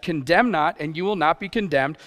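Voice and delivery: usual speaking voice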